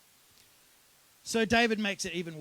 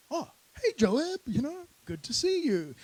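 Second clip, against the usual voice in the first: high-pitched cheery video-call hello; fake casual greeting; 7 semitones higher, 4 dB louder than usual. David greets Joab over a video call, fake casual. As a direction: greet a colleague in a high, put-on chirp.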